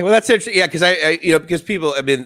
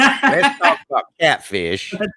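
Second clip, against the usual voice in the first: folksy country drawl. Pretend. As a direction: lay on a lazy country twang, teasing. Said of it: "That's totally not how you sound".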